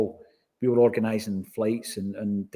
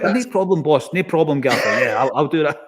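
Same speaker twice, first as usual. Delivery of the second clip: eager yes-man; 3 semitones higher, 6 dB louder than usual. Retold or imitated. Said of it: "I'm like".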